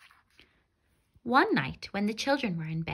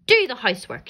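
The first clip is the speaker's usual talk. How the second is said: bossy witch; 4 semitones higher, 8 dB louder, faster than usual. Acted. Witch orders Gretel.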